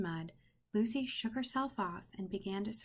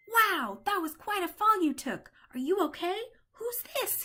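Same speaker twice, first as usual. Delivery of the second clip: excited puppy voice; 12 semitones higher, 8 dB louder than usual. Acted. Farley the dog character speaking.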